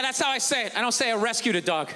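usual speaking voice

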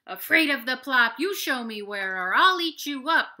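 very loud threatening bear roar. Acted. Big brown bear roaring a threat at the littlest rabbit. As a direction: roar it with menace. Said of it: "roars the big brown bear".

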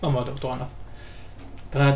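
ordinary talk

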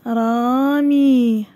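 very slow, stretched vowels; each long vowel held; drawn-out word reading. Shown as a demonstration. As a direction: sound the word out slowly for young pupils. Teacher sounds out word for pupils.

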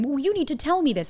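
high, insistent complaint; petulant demand. Retold or imitated. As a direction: play the reactive partner. Acting out a reactive demand.

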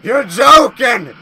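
loud, disbelieving yell; a real startled shout of disbelief; shocked shout. Real, not acted. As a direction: belt it out in shock. Shocked at the monster.

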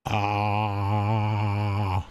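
open-mouthed aah for the doctor; tongue held down saying aah. Acted. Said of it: "Can you say, "Ah," for me?".

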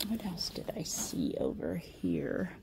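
hushed musing to herself; whisper. Real, not acted. Shopper whispering to herself while browsing. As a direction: whisper as you look around.

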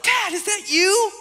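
high, laughing imitation of excited kids; daughters asking. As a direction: voice the girls asking their dad, giggling. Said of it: "they're like".

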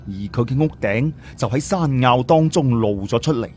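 plain reading voice

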